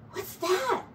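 high, startled little cry; startled Mouse. Acted. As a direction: jump at the sound, squeak it out.

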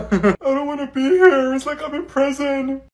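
high, slow whine; whiny. Acted. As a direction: complain like a bored student. Whiny student.

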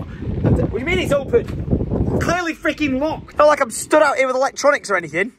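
high, exasperated sarcasm; sarcastic complaint. Real, not acted. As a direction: grumble it with mock outrage. Locked out, complaining.

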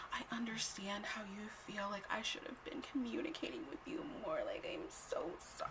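whispered fake-sorry apology; mocking whisper. Mocking the guy's apology in a whisper. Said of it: "okay, he's like".

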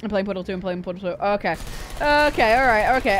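ordinary talk

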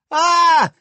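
loud high startled scream. Acted. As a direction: scream at the talking squirrel. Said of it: "they screamed".

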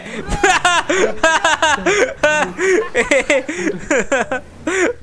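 long, high-pitched burst of laughter; uncontrolled laughter. Real, not acted. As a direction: crack up helplessly at the game. Cracks up at the game. (ज़ोर से खिलखिलाकर हँसना)

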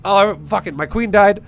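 mock panic. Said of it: "But instead going".

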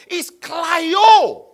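"klio" shouted high and loud; emphatic shout. Shown as a demonstration. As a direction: burst out on "klio", drawn out and forceful. Preacher bursts out the contrasting word.

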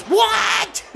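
very high, shocked yelp; can't believe what just happened; sudden high-pitched what; stunned what. Real, not acted. Unexpected goal by own team.

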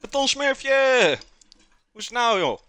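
excited greeting. Real, not acted. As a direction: sing out the greeting to a friend. Greeting a chat viewer.

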